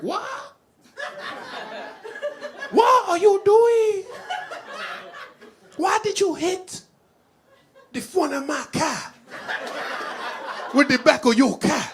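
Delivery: put-on very high, loud, drawn-out Haitian accent; Haitian lady. Comedian mimics the Haitian lady who hit his car, Haitian-accented screech. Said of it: "some crazy-ass Haitian lady".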